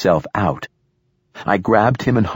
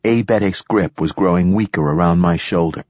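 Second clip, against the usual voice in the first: plain reading voice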